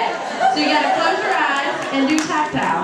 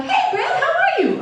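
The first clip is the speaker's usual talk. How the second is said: put-on high, drawn-out little boy greeting; excited young son. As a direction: greet a grown-up in an excited kid voice. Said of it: "his son arrives, runs in the door".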